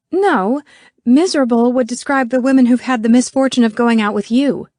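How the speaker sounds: loud, high comeback; heated retort. A narrator's reading. Merkel hits back at Jerry's jibe, heated. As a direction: hit back forcefully.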